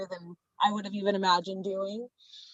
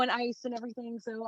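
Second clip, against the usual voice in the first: ordinary delivery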